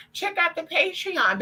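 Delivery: high, slow mock promo voice; mocking other creators. Mocking other streamers to viewers, high slow sing-song. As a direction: parody a creator pushing their Patreon. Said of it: "'Cause everybody".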